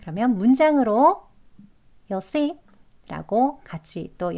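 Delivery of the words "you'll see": YOU'LL as short "yuhl" in "You'll see"; casual, contracted "You'll see"; reduced you'll. Shown as a demonstration. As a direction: run "You'll see" together quickly. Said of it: "간소한 발음".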